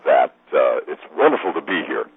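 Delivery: low, polished announcer delivery. Shown as a demonstration. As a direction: drop well down into your announcer voice. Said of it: "one of my announcer voices".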